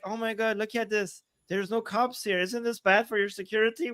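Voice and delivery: soft, mock innocent concern; mock innocent robber. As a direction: feign worried innocence, softly.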